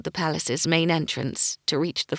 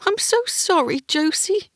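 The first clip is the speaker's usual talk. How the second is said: high, strained, near tears; choked apology; 14 semitones higher, 4 dB louder than usual. Acted. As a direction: force it out past a lump in the throat. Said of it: "he choked out".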